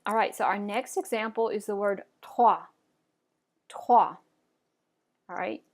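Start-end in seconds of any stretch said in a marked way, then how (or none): 2.2-2.6 "trois" as "trwah", R from the throat; French "trois", guttural R after the T. Shown as a demonstration. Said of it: "pronouncing that guttural R". 3.7-4.2 loud "Trois" as "trwah", R from the throat; French "Trois", strong guttural R after the T. Shown as a demonstration. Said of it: "pronouncing that guttural R".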